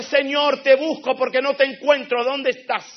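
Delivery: loud, pleading call to heaven; calling to God. Retold or imitated. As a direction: shout up to heaven searching. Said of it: "pega un grito al cielo y dice".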